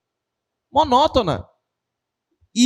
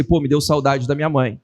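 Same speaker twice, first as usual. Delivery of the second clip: lower, sincere and heartfelt; serious tone; 6 semitones lower than usual. Shown as a demonstration. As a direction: say it as a real confession. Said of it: "tem hora que eu tô falando sério com vocês". (tom sério e mais grave)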